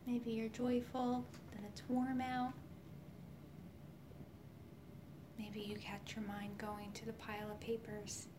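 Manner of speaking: soft whispered meditation voice; right in your ear. Acted. Leads a guided healing meditation.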